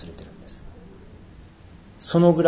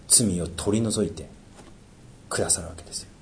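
hushed; 5 semitones lower, 12 dB quieter than usual. Real, not acted. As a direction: voice drops to a near whisper. 神の赦しを静かに語る. (ささやくように静かに)

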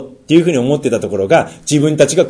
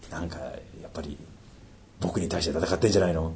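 much lower and softer, mumbled worry; doubting inner voice; 7 semitones lower, 13 dB quieter than usual. Retold or imitated. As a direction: voice a fearful believer's doubt. 疑う信者の心の声. (小声で不安げに)

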